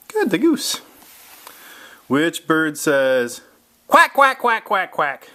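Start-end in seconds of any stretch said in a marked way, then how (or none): 3.9-5.3 quacks starting high and loud, then fading down; playful duck quacking for the child. Shown as a demonstration. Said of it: "I'll do the sound".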